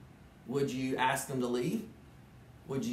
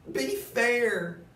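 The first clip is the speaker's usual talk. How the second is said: begging; 9 semitones higher, 5 dB louder than usual. Acted. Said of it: "Thidwick begged with a lump in his throat".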